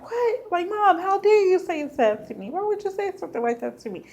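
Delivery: high, indignant squeal of disbelief; high hurt disbelief, fast at the end; shocked outrage. Retold or imitated. Hurt outrage at mom's words.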